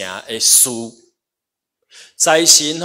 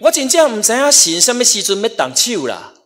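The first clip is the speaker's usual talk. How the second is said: high, loud preacher's exhortation; forceful preaching; 10 semitones higher, 6 dB louder than usual. Real, not acted. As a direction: preach it hard, voice raised. Preaches with raised voice.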